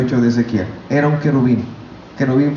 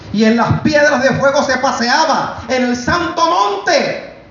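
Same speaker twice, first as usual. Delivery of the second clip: high, drawn-out preacher's cry; ringing high-pitched shouted questions; imitated divine shout; 10 semitones higher, slower than usual. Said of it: "me gritó, y yo también lo grité".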